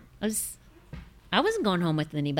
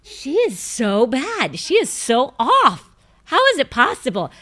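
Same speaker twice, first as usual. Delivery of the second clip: mock exasperation; 5 semitones higher, 6 dB louder than usual. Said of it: "I was like".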